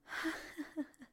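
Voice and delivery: high, soft, winded giggle; breathy laugh. Pretend. Recovering after the countdown, breathy laughter. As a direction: laugh softly while catching breath.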